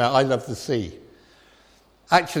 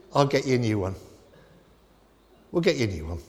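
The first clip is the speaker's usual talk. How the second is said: put-on softer, lower, comforting a child; soothing parent; 5 semitones lower, 5 dB quieter, faster than usual. Parent soothing a crying child over a broken toy, softly. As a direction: gently promise a replacement.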